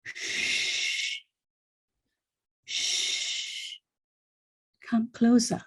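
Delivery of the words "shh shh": long, soft shushing; hushing. Acted. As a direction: draw out two slow hushes. Hushing the listener.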